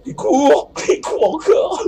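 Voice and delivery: shrieking with laughter while fleeing; high panicked squeal. Real, not acted. Panicked chase, squealing commentary.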